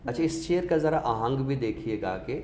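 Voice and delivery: normal talking voice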